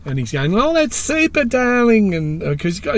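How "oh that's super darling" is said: high-pitched mock British gush; hammed-up British fashion designer, pitched way up; British accent. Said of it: "he's got a British accent".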